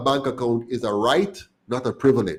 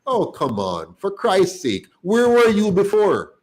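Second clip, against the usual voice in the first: higher and louder, exasperated and fast; real frustration boiling over at the rival parties; heated outburst; 7 semitones higher, 4 dB louder than usual. Real, not acted. Heated rant at the parties, higher, louder. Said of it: "Sorry, I'm getting heated now".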